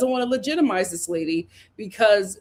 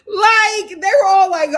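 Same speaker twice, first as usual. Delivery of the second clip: laughing hard; 10 semitones higher, 7 dB louder than usual. Real, not acted. Recapping Ashley crying scene.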